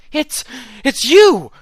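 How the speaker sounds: high and loud, shocked recognition; gasping excited. A narrator's reading. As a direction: burst out in sudden recognition. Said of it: "she suddenly flailed and gasped".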